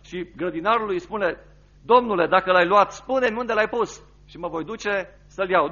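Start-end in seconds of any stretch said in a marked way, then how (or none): 1.8-5.7 fervent preached quote. Acted. Mary's plea in the sermon.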